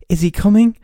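hammed-up kid in a Christmas ad; mock TV ad kid. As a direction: push the excited ad child further. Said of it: "Everything you see on T V is like".

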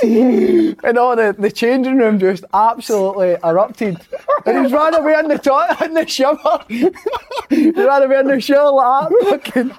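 high, loud, breaking into laughter mid-sentence; can barely get the words out for laughing; laughing while telling. Real, not acted. Telling teammates the prank story, laughing hard.

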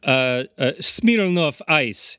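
slow, put-on Russian accent; mock Russian accent. Shown as a demonstration. Comic proving an accent isn't speaking the language, mock Russian accent. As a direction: drag out Smirnoff in fake Russian. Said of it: "doesn't mean I speak Russian".

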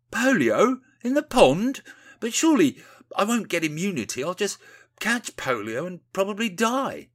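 high, loud incredulous questioning; aghast disbelief. Acted. Windy protesting the polio plan, aghast. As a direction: squawk the alarm, pitched well up.

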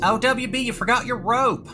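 high mock worried call; high teasing call. Teasing call to jumping player.

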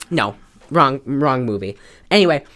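usual speaking voice

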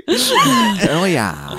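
put-on high, slow West Country accent. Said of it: "Oh, West Country".

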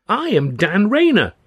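high, loud, drawn-out self-introduction; eager cry. A narrator's reading. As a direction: announce yourself proudly, slow and high. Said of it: "the stripling cried".

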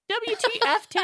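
very high-pitched mock outrage; shocked disbelief. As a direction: squeal it at a friend in comic disbelief. Re-enacts shocked message to friend.

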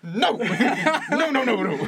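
high, loud, laughing "no, no, no"; laughing mock pleading. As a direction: beg for mercy through laughter, hammed-up. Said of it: "So I was like".

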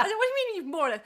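high, laughing incredulous question; laughing disbelief. Retold or imitated. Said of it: "I said".

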